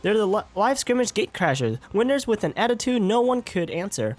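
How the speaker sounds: game announcer. Acted. Host presents Mizuki to the crowd, announcer style. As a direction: announce the next contestant with showmanship.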